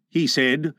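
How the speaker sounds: ordinary delivery, nothing marked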